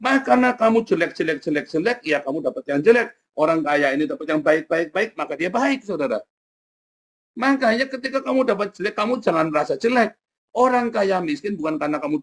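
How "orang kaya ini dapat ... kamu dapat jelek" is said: normal talking voice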